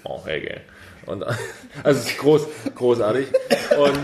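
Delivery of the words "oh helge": very low, soft mumbled "Oh, Helge"; lightly voiced Maffay; [name] impression. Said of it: "wie er [name] ankündigt".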